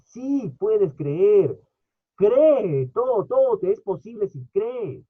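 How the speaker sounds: preacher crying out cree; fervent exhortation. Real, not acted. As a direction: urge the crowd to believe.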